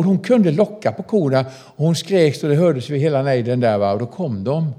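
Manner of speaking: ordinary talk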